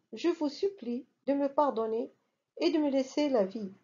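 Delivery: usual speaking voice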